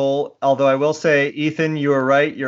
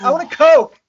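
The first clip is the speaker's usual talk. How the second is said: put-on high, loud kid demanding a Coke; mock kid yell from the backseat; 14 semitones higher, 9 dB louder than usual. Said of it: "yelling from the backseat".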